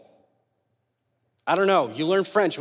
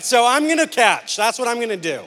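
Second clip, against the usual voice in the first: higher and louder, playfully insisting; mock insistent claim; 6 semitones higher, 4 dB louder than usual. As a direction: act the stubborn kid claiming the spot. Boy insisting in retold standoff.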